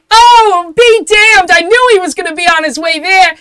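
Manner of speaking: loud, very high gushing falsetto; excited townsperson. Acted. Another onlooker thrilled he is on his way, high gushing shout. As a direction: gush it at full volume.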